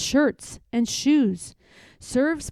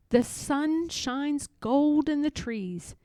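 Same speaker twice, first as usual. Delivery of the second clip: ordinary delivery, nothing marked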